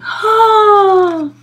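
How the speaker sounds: sharp high gasp with a laugh. A narrator's reading. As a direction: gasp in mock shock. House blown down.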